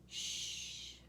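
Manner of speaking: usual speaking voice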